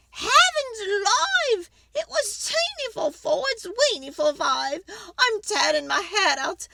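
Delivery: put-on high, loud complaining old lady; fretful old lady. Old lady begging the wise man for help, high and pleading.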